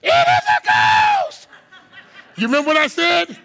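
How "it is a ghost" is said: high, slow shriek of terror; panicked high cry of fear; terrified cry. Acted. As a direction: scream it like you see a ghost. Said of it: "they were terrified".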